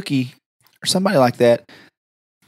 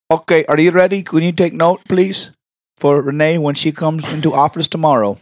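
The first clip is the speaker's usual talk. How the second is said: prank caller voice; 3 semitones higher than usual. Acted. Asking the receptionist for Renee, prank caller voice. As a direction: put on a silly caller voice. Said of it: "Why can you talk like, exactly like that".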